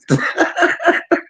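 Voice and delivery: high laugh at own story; real laugh. Real, not acted. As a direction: burst into high giggling.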